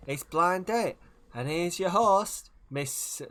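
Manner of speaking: high, loud TV host introduction. Acted. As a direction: announce the show high and loud, drawing out the host line.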